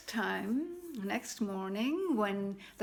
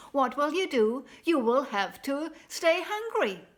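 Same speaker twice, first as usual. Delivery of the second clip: insistent king; 4 semitones higher, 8 dB louder than usual. Acted. King presses the cobbler.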